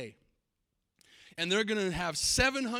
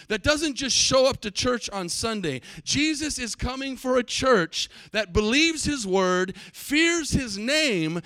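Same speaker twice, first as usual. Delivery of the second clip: loud, rising pulpit preaching; fiery revival sermon; full-force preaching; 3 semitones higher, 9 dB louder than usual. Acted. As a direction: build it up and hammer each line to the congregation.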